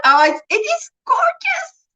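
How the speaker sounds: high, near-tearful gush; real, choked-up excitement; overcome with delight. Real, not acted. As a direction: squeal it out, almost crying from joy. Overwhelmed by a viewer's comment.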